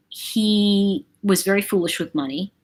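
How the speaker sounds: ordinary delivery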